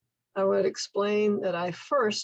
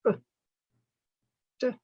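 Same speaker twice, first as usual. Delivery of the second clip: ordinary delivery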